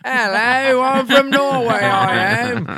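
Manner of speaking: loud, high mock Norwegian accent. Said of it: "She's got a Norwegian accent".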